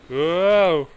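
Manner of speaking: loud, high startled cry; startled whoa. Real, not acted. Player startled by an ambush in the game, shouting loud. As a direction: jump at the sudden attack.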